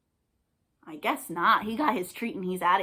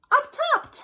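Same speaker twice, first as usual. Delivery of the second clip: surprised; 4 dB louder than usual. Real, not acted. Spotting the last goblin.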